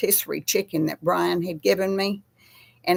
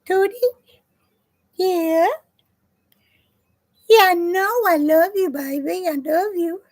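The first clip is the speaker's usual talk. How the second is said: cooing to cat; 12 semitones higher, 5 dB louder than usual. Real, not acted. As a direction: pour love on the cat up high.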